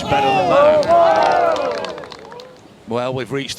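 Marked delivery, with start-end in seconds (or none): 0.0-1.3 high, loud eagle call; excited call. Real, not acted. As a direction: sell the moment big. Eagle made.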